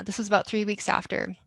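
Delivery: ordinary talk, nothing marked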